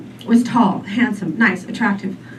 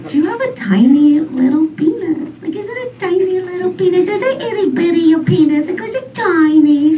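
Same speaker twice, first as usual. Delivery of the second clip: higher, prying singsong voice; mock high-pitched questioning; 8 semitones higher than usual. Comic asking a date about his size in a prying voice. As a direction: pitch it up and pry. Mocking the questions she wants asked.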